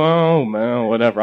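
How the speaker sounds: low, deflated "whatever"; dismissive retold reaction. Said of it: "And I go".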